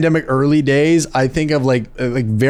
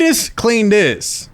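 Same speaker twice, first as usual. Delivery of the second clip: high, drawn-out parental call; mock yelling; 7 semitones higher, much slower than usual. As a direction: call it out slowly like a nagging parent. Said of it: "That's a great yelling name".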